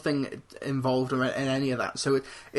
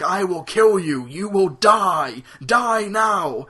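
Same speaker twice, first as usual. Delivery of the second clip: louder, slow mock menacing robot; robot threat; 5 semitones higher, 7 dB louder, slower than usual. Autobot threatening enemies in mock menace. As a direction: growl it like a hammy killer robot. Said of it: "so much dialogue, like".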